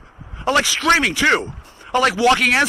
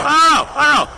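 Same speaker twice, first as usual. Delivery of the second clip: loud, high burst of frustration; exasperated shout; 5 semitones higher, 3 dB louder, faster than usual. Real, not acted. Ranting at the government, a frustrated shout. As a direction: throw the words out in exasperation.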